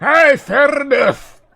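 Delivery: slow, loud, high booming dwarf cheer; hearty shout. Acted. Dwarf shouting to the party, slow booming cheer. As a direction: bellow it out gladly.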